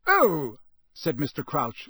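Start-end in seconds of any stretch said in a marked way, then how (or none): 0.1-0.6 louder, higher "oh"; slightly taken aback; mild surprise. A narrator's reading. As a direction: notice someone unexpectedly. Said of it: "in mild surprise".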